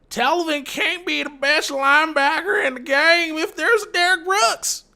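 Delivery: high-pitched, put-on mocking voice; mocking SpongeBob meme voice. Commenters mocking his linebacker pick, in a high meme voice. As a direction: mimic doubters in a silly high voice. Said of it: "in that SpongeBob meme".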